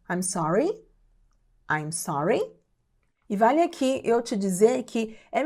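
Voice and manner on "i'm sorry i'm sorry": "I'm sorry" said low, rising on "sorry"; question intonation. Shown as a demonstration. Teacher models not having heard, "I'm sorry" with questioning rise. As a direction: turn "I'm sorry" into a question with a final lift. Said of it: "com essa entonação de pergunta".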